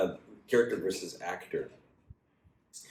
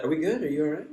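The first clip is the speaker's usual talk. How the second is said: mock tender question; 8 semitones higher, faster than usual.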